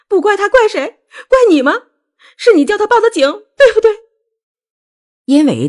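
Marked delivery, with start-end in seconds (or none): 0.1-3.9 very high, loud screamed accusation; shrill, loud roar of rage; furious yelling. A narrator's reading. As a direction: scream the questions at mother-in-law. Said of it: "冲着韩明玉大喊大叫".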